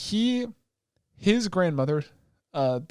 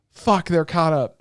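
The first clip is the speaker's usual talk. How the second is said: loud, slow, drawn-out exclamation; loud acted dismay; exasperated outburst; 3 semitones higher, 7 dB louder, slower than usual. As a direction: curse it out slowly and loudly. Acted reaction to catching up.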